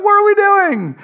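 high, alarmed cry; panicked fish. Acted. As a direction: ask it in a squeaky panic. Fish panicking, voiced by preacher.

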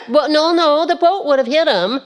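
ordinary talk

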